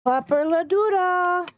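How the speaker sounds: high, slow sing-song puppy talk; talking to a dog. Real, not acted.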